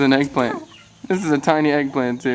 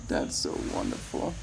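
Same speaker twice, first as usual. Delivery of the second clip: whispered; 9 semitones lower, 10 dB quieter than usual. Real, not acted. Cooing at birds living together.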